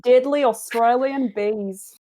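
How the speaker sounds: put-on trying hard at an Australian accent; a strained go at Aussie vowels; mock Australian accent; attempted Australian accent. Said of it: "Trying really hard, guys".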